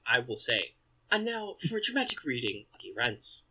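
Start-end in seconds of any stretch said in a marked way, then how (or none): 1.1-3.4 mock posh British announcer intro; posh British accent. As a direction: play a pompous British presenter. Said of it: "me doing a posh British accent".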